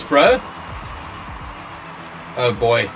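ordinary talk, nothing marked

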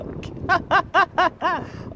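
sudden high laugh; real surprised laugh; high burst of laughter. Real, not acted. Opens the fish box.

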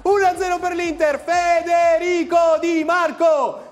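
goal commentary. Real, not acted. Play-by-play goal replay.

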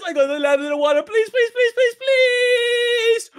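high, loud falling scream into begging; panicked pleading. Acted. Player falling toward the water, panicked pleading. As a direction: beg frantically at the top of your voice.

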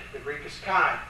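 ordinary talk, nothing marked

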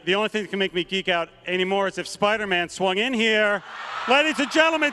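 ordinary talk, nothing marked

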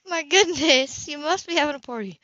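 a lightly voiced clerk, amazed at the carts; chatty clerk. Checkout clerk voiced by narrator.

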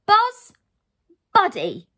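loud exclaimed names; shocked cry. Acted. Heroine spots two troublemaking brothers, shocked cry. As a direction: call each name out sharply. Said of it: "Lucy cried".

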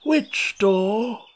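put-on a slow, drawling old frog. A narrator's reading. Old frog drawling at Alice at the door.

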